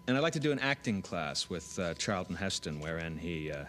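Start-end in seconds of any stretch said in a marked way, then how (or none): none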